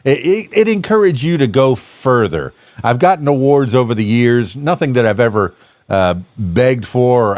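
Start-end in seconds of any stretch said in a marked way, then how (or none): none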